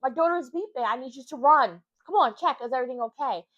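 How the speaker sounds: high, loud urgent pleading; panicked mom. Retold or imitated. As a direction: push the nurse to hurry, half-mock alarm. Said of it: "I'm like".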